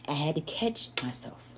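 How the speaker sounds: hushed, breathy whisper; mother ashamed of nearly hurting her son, whispering. Real, not acted.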